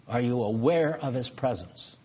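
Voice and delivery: ordinary delivery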